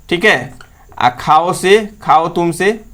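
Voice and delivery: ordinary talk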